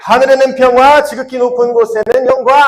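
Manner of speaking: high, loud rallying recitation. Shown as a demonstration. Teacher retries the verse as if Jesus is here, loud praise. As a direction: proclaim it loudly as if to Jesus present. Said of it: "예수님 여기 계신다고 생각하고".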